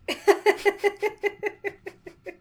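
long, high, loud laughing fit; real laughter. Real, not acted. Reader breaking up after the alias line, laughing. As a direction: burst out laughing, tailing off gradually.

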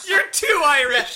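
high, loud, drawn-out words through laughter; laughing mock quote. Co-host echoing a joke about the actor, laughing. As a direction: crack up while echoing the mock order. Repeats the joke while cracking up.

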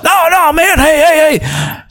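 very high, insistent holler; mock yelling. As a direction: imitate a man calling after someone who ignores him. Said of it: "the guy keeps yelling".

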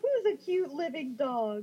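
put-on baby talk to a dog. Ghost admires a living dog, cooing baby talk. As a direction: draw it out, doting on the pup.

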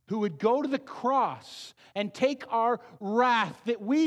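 slow, loud, ringing proclamation; fervent pulpit preaching; preaching at full force. Acted. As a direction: proclaim the good news, slowly.

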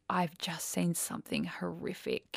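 hushed and slow, barely voiced; shaken whisper. Retelling her partner's call, a shaken whisper. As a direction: whisper it like you're still in shock.

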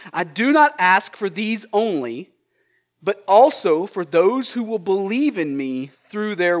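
scripture reading. Acted. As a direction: read it out with weight. Reads scripture aloud.

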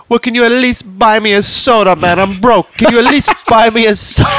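high, pleading mock Jamaican voice; horrible Jamaican accent. Broke dealer begging a tourist for soda, bad Jamaican accent. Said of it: "horrible Jamaican accent".

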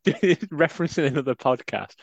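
ordinary talk, nothing marked

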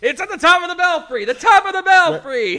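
mock shout. Mocking the repeated line.

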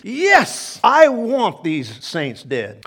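proclaiming. Acted. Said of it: "God the Holy Spirit proclaims".

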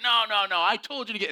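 defensive. Retold or imitated. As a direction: boss brushing off blame. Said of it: "He's like".

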